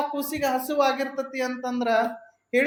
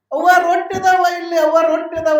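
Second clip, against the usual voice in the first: put-on loud, higher, eager child's cry of discovery; excited child; 4 semitones higher, 8 dB louder than usual. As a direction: call out to mother like a thrilled hungry child. Child excitedly finding bread.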